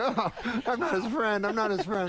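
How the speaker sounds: high, hurried, laughing; laughing backpedal. Retold or imitated. The young comic backpedaling to Rodney, laughing. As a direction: quickly take it back while cracking up. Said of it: "I'm like".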